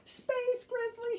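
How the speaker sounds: high, slow frightened wail; scared wail. Acted. Sister tells Brother her fear, high slow wail. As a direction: draw it out high like a scared cub. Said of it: "she wailed".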